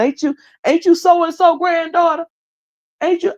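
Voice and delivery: nosy small-town call-out. Retold or imitated. Nosy neighbor calling out in line.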